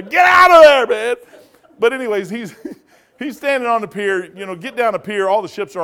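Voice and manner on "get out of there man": high, loud shout to get off there; retold shout. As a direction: bellow the order, alarmed. Yelling at Carl on pier.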